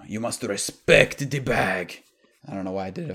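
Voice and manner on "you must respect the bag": slow, mock wise-master Japanese accent drifting Spanish; botched Japanese accent. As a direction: play a bad Mister Miyagi in a Japanese accent that slips into Spanish. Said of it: "Spanish accent while I was trying to do a Japanese accent".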